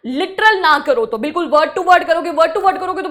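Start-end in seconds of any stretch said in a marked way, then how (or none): none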